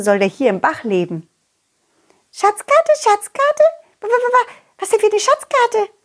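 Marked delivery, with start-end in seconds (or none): 2.3-5.9 high, stammering fish voice, amazed; tiny fish stuttering in astonishment; surprised little fish. Acted. Fish startled by treasure map.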